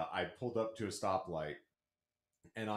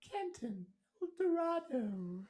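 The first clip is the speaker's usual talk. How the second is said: high, slow announcer sign-off; grand announcer name; slower than usual. Pretend. Signing off the show as an announcer, high and slow.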